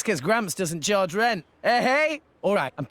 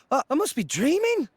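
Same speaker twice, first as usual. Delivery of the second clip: stunned disbelief; 7 semitones higher than usual. Acted. Stunned by huge reward.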